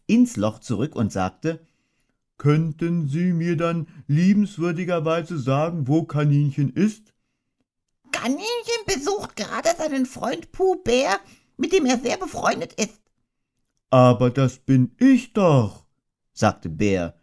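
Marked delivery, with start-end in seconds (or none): 2.4-7.1 higher, courteous bear; overly formal little bear; polite Pooh voice. Acted. 8.1-13.0 squeaky, innocent lie; fibbing Rabbit. Acted. Rabbit pretends to be out. 13.9-15.9 louder, slower, baffled bear; mild disbelieving outburst; astonished. Acted. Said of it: "überaus erstaunt".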